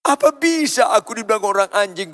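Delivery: high, imitated wail to God; retold tearful plea. Preacher recalling his cry to God on reading the verse, pleading. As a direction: plead upward, acting out past weeping. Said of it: "nangis saya, nangis, nangis".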